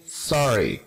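"sorry" stressed on SOR; first-syllable stress. Shown as a demonstration. Teacher modelling word stress, "sorry" accented on first syllable. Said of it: "primera sílaba".

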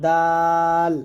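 long thin "দাল", tongue tip at upper teeth roots. Shown as a demonstration. Teacher demonstrating the light letter, thin long "দাল". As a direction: keep "দাল" light and drawn out. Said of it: "দাল পাতলা".

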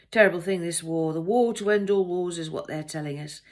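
loud, pontificating train passenger; opinionated male passenger. A narrator's reading. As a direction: hold forth loudly to a stranger.

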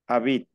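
"Habit" ending on T, no E after it; final T sounded. Shown as a demonstration. Teacher corrects student, "Habit" ending on the T. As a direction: stop "Habit" on a pronounced T. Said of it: "tú pronuncias la T".

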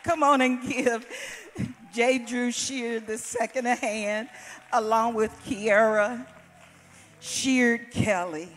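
rally the room to clap, stretching the name; calling out for applause. Real, not acted. Church host calling the congregation to applaud the singers, loud.

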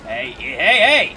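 rising to a loud hey; calling out to settle someone down. Real, not acted. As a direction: build from low to a shout.

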